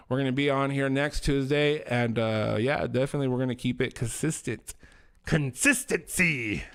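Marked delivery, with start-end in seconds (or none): 5.2-6.6 slow, drawn-out "Consistency.". Shown as a demonstration. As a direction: stretch "Consistency." slowly in a put-on tone. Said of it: "I don't know why I said it like that".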